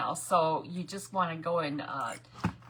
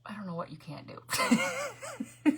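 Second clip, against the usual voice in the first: laughing whisper; 9 semitones higher, slower than usual. Real, not acted. Laughs at herself mid-sentence.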